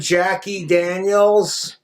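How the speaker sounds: Daniels drawn out slowly; slow name drop. Shown as a demonstration. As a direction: linger on "Jackie Daniels" like a punchline. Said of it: "Have you met my girlfriend".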